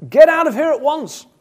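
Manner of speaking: loud, high, outraged order; an indignant burst of temper; furious shout. Acted. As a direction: shout it in fury, pitched right up. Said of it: "he shouts furiously".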